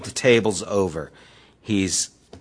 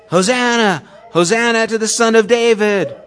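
high, loud joyful cry of Hosanna; kids shouting; 9 semitones higher, 6 dB louder than usual. Retold or imitated. Children in the temple hailing Jesus, loud high shout. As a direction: cheer it out like children running through the temple. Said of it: "They're all shouting out".

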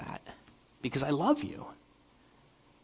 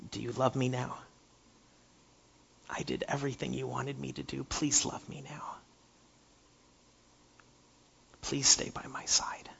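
softer, anxious whispered plea; pleading whisper; 10 dB quieter than usual. Retold or imitated. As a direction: beg for love in a hush. Child whispering to God.

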